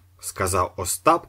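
ordinary delivery

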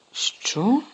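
startled high what; taken aback. Acted. Harry stunned by the request, startled. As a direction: blurt it out, stunned. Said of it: "сторопіло мовив Гаррі".

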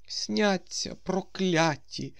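put-on high, slow old man's grumble; old man's curse. A narrator's reading. Old man curses his dreams, high and slow. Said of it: "знов почув Остап голос старого".

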